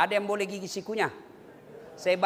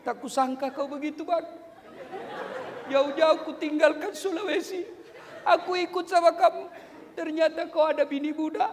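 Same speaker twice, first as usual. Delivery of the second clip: acted hurt wife; 4 semitones higher than usual. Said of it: "Boleh kalau marah macam itu".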